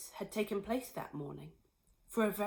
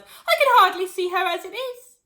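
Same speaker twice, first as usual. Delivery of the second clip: wailing; 11 semitones higher, 13 dB louder than usual. Acted. Missus Cranky wailing over her tiny mother. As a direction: wail in despair. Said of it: "Missus Cranky wailed".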